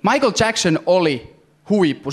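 normal talking voice

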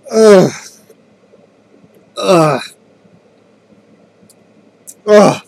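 loud gagging ughs; disgusted groans. Real, not acted. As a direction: groan through the burn. Reacting to awful drink.

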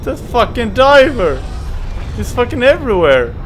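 frantic high panicked yelling; being chased. Real, not acted. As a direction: flee in panic, shouting. Fleeing the diver.

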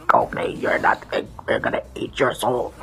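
whispered villain threat. Retold or imitated. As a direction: hiss it like a sinister fighter. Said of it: "that's what Goki would say".

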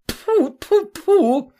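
high, disgusted spitting sounds; a lady spitting at a crocodile, high and disgusted. A narrator's reading. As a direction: spit it out in disgust. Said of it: "заплевалась она".

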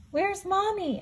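high little owlet voice asking; a small owl calling for its mother; worried owlet. Acted. Owlet Sarah asking her siblings where Mommy is, high and worried. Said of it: "asked Sarah".

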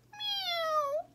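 very high kitten meow; plaintive meow. A narrator's reading. Kitten crying for help on the stairs, high meow. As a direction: cry out a lonely little meow. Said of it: "she cries once".

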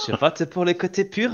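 put-on French with a hammy German accent. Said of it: "Il fait mal ton accent allemand".

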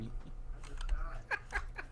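a quick breathless laugh; breathy high laugh. Real, not acted. Laughs at friend dying.

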